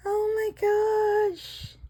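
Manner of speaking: high slow amazed gasp; high drawn-out oh my gosh. Real, not acted. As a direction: stretch it out in wonder. Gasp at marbling.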